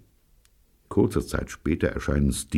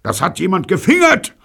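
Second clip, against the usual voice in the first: high, loud outraged yell; angry raised-voice outburst; shouting; 9 dB louder than usual. A narrator's reading. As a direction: yell the accusation at full pitch. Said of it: "schreit Steve".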